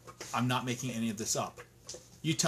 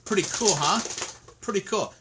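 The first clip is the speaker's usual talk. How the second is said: sarcastic; 6 semitones higher, 4 dB louder than usual. Real, not acted. Sarcastic remark on incriminating report.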